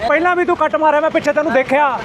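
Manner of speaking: high, heated shouting at a driver; real angry roadside argument; angry yelling. Real, not acted. Roadside quarrel with truck driver.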